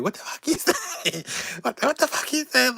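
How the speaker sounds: high put-on squeal of outrage; mock outraged friend. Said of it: "I met her at security like".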